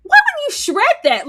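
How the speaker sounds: high, loud disbelief; outraged what. Real, not acted. Friend reacts to shredded papers, loud disbelief. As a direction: cry out in shock. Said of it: "I said".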